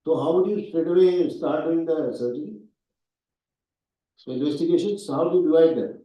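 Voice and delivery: loud, high exasperated scolding; scold, then demand an answer; cross examination. Real, not acted.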